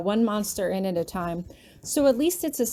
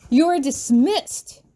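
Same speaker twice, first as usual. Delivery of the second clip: loud, slow, higher yell; 6 semitones higher, 6 dB louder, much slower than usual. Acted. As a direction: bark the order out slowly. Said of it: "Grabby yells".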